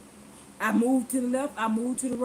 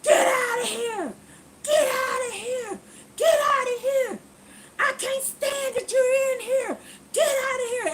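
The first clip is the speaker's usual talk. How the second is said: screaming woman; 10 semitones higher, 4 dB louder than usual. Retold or imitated. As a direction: scream her out of the store, pitched way up. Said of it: "started screaming".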